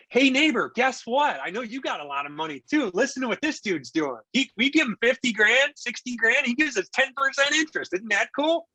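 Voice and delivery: bubbling over to share the news; excited neighborly pitch. Retold or imitated.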